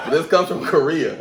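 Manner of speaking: high burst of laughter; laughing. Real, not acted. Laughing at chicken stereotype.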